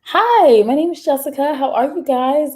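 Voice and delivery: high, bright customer-service voice; code-switched voice. Shown as a demonstration. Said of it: "I could do it right now".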